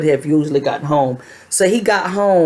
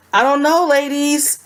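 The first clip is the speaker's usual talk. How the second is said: unsure shrug; 9 semitones higher than usual. Real, not acted. Talking to viewers, unsure.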